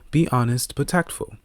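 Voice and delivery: usual speaking voice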